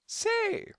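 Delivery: high parrot squawk. A narrator's reading. Parrots echo the detective's word, high mimicking squawk. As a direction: squeak it back like a parrot. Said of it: "They just parroted".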